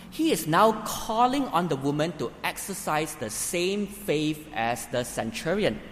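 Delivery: ordinary delivery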